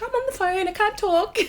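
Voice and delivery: high-pitched, acted-out excuse; hammed-up high little voice; retold brush-off. Acting out own flustered reply.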